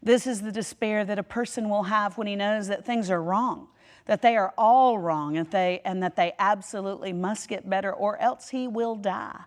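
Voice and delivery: quote reading. Acted. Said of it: "he puts it this way".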